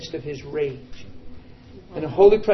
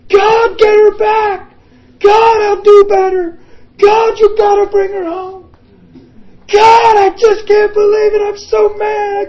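anguished high shout to God; screaming loud; 14 dB louder than usual. Retold or imitated. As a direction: scream it at God, furious and desperate. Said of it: "screaming loud".

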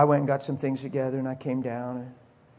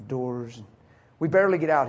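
ordinary delivery

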